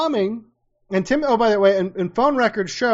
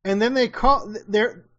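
ordinary talk

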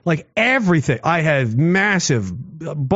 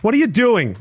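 higher-pitched mock telling-off; mock scolding; 6 semitones higher than usual. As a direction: scold an object as if it misbehaved. Scolding the haunted microphone.

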